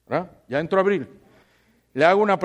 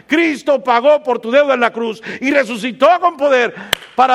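high, loud, urgent sermon; preaching at full force; 7 semitones higher, 5 dB louder than usual. Acted. Pastor urging the congregation to stop looking back, loud preaching. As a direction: drive it home to the whole church.

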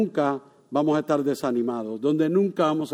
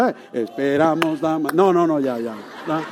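ordinary talk, nothing marked

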